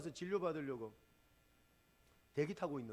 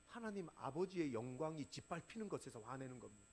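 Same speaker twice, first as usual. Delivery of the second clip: ordinary talk, nothing marked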